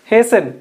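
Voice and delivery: హేసెన్ said as HAY-sen; హేసెన్ with no T sound; silent T. Shown as a demonstration. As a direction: drop the T in హేసెన్. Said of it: "టీ పలకకూడదు".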